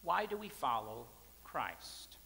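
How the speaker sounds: ordinary delivery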